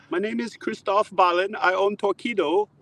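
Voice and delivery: put-on high, formal German-accented intro; German caller. Torqeedo owner introduces himself, German accent. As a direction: introduce yourself like a German CEO.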